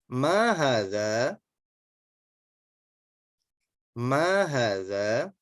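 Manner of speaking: careful read-aloud for the class to repeat; teacher modelling Arabic phrases slowly for students; slow model reading. Shown as a demonstration. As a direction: read each Arabic phrase slowly and clearly, pausing for repetition. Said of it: "saya bacakan dulu, silakan diikuti".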